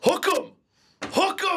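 very high, loud fan chant; frat bro. Real, not acted. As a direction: chant it like a rowdy college fan. Texas fan cheer.

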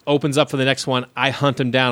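ordinary talk

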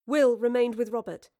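usual speaking voice

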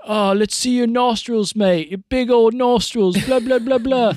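high, loud mocking London taunt; imitating the troll taunting him on video chat. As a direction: jeer like a cocky bully. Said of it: "starts just taunting me".

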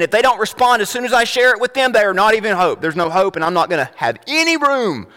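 mock impatient dismissal; impatient rant, starting high and quick. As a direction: act out writing someone off. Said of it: "we become impatient with them".

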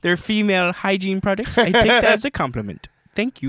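high, prissy nerd voice; movie character voice. Retold or imitated. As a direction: quote it like a smug dork. Quoting movie line in voice.

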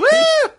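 high, loud "woo"; sudden high-pitched party whoop; whooping cheer. Acted. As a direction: let out a piercing whoop. Cheers at the Narnia joke.

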